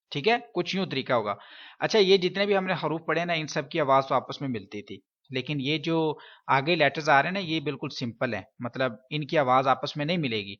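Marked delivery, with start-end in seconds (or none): none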